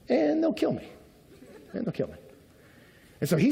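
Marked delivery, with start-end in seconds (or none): 0.1-0.9 high, panicked squeak of fear; panicky fear. Retold or imitated. Abram panics about the Egyptians. 1.7-2.2 low, soft, sinking repeat; quiet dread. Retold or imitated. Abram repeats the fear quietly.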